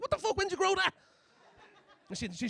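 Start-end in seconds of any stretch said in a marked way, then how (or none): 0.0-0.9 much higher, loud shocked outburst; shocked shriek. Retold or imitated. Seeing friend Emma's shiwi at a festival, shocked shriek. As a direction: shriek it, freaked out.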